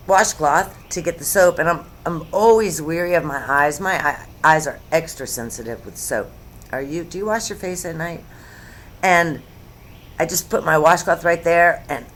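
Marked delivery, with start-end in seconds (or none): none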